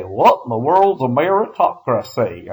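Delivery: puzzled student in a put-on American country accent; mock country accent. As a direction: ask it like a bewildered country bumpkin with a country accent. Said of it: "why you're speaking in a con-, country accent".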